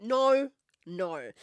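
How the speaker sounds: emphatic no. Real, not acted. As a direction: shut the idea down hard. Emphatic refusal of dating idea.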